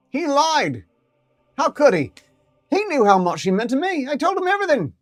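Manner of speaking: high, loud hurt ferret voice; betrayed ferret. Acted. Ferret realising the doctor lied, high and hurt. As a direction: cry out at the betrayal.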